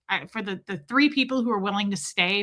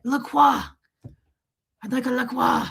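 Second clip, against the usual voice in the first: higher, hammed-up French "la KWAH"; all-out French accent; 4 semitones higher than usual. Shown as a demonstration. Host demonstrating an order, all-out French accent. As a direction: pull out all the stops, extra-cringe French. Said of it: "Pull out all the stops".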